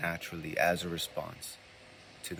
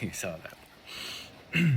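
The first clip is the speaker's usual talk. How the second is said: breathy laughing aside; 3 dB quieter, much slower than usual. Real, not acted. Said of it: "laughing aside after slip".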